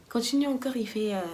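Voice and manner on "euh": ordinary delivery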